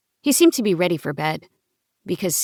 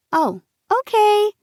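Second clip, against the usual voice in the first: put-on high, slow little girl; small child voice; 5 dB louder, slower than usual. Child told mother leaving.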